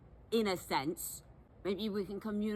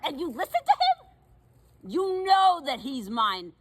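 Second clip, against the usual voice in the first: jealous indignation; 6 semitones higher, 6 dB louder, slower than usual. Acted. Jealous outburst over ex.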